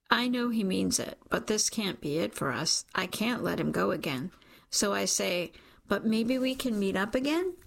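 usual speaking voice

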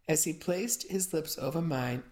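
ordinary delivery, nothing marked

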